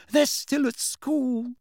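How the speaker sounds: high, drawn-out maternal protest; exasperated objection. A narrator's reading. Mother arguing back at her husband, high and exasperated. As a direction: object slowly in a high, upset voice.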